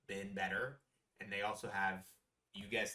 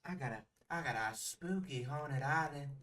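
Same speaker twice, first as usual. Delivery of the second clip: Lena Dupree; 4 semitones higher than usual. Retold or imitated. She tells him of a spooky haunted island, drawled impression. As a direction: imitate the island hostess luring guests. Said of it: "She goes".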